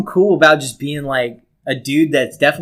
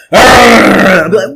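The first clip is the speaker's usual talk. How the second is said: laughing; 7 semitones higher, 12 dB louder, much slower than usual. Real, not acted. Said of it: "take it".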